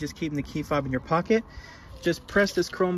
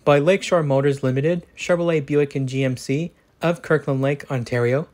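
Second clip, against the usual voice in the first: louder, even-paced dealership ad read; sponsor ad read; 2 semitones lower, 6 dB louder than usual. Acted. Car reviewer credits the dealership that lent the vehicle, in a louder ad-read voice. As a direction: read the sponsor spot like a radio commercial.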